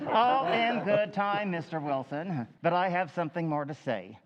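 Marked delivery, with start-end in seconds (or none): 0.1-2.5 teasing. Acted. Chairman teasing the impatient Wilson.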